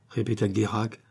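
ordinary delivery, nothing marked

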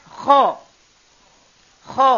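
Kho rasped hard at the top of the throat; upper throat kho. Shown as a demonstration. Teacher showing students the top throat letter, rasping demo.

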